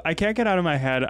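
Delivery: normal talking voice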